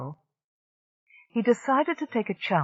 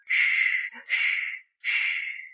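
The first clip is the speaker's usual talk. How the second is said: whispered shush; much slower than usual. A narrator's reading. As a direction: hush them with a quick shh. Seg keeps hushing everyone.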